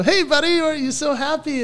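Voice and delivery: put-on high, sing-out greeting to a small child; dad's chirpy question. Dad to his beaming three-year-old in the car, chirpy. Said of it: "And I go".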